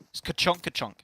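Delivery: imitated ka-chonk weapon sound; clipped mock gun noise; DBS nail gun noise. Shown as a demonstration. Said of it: "you say Bajonka Chonk".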